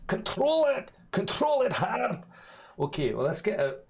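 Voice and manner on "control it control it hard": strained, very high-pitched self-command; mock self-scolding. Streamer ordering himself to control his accents, high mock strain. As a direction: squeeze it out high, dragging out the last word.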